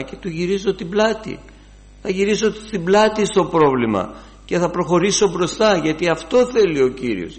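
high, loud preaching voice; fervent preaching. Acted. Preacher exhorts the congregation, fervent. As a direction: drive the message home.

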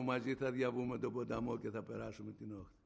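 low, softened reassurance; tender divine promise, spoken quietly; soft voice of Spirit. Retold or imitated. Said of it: "Ήρθε η φωνή του Πνεύματος του Αγίου, μαλακιά".

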